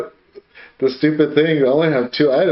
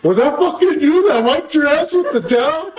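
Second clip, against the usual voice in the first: laughing dad quote; 9 semitones higher than usual. Retold or imitated. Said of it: "He's like".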